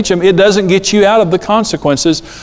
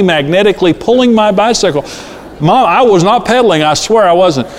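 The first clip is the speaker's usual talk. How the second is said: hammed-up child protesting innocence, rising higher; excuse-making kid; 3 semitones higher than usual. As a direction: plead a far-fetched excuse like a kid. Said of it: "And they're always".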